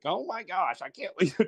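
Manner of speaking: high-pitched mock disbelief, laughing through it; Ken Hitchcock. Guest mimics a coach griping about his player, high-pitched voice. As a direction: imitate a coach's squeaky-high exasperation. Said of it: "he has this really high-pitched voice".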